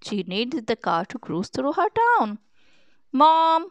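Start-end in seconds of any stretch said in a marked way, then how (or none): 3.1-3.7 high, loud call for Mom; child calling for Mom. A narrator's reading. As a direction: call to a parent in another room. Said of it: "Woody called".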